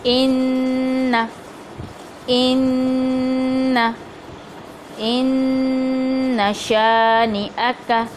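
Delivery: इन्ना drawn out very slowly with a held ghunna, nun not stretched; slow recitation. Shown as a demonstration. Teacher modelling इन्ना शानिअक slowly for students. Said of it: "नून और हमज़ा को लंबा नहीं करेंगे".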